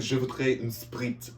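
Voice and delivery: put-on slow, heavy French accent on "Je voudrais une Sprite"; mortified student ordering in a French accent. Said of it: "Just 'cause I was in the accent".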